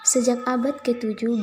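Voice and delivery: ordinary talk, nothing marked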